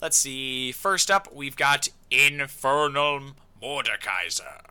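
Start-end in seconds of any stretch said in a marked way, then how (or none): 2.1-4.6 slow, drawn-out villain voice; dark lord announcer. Acted. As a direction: announce it with mock menace.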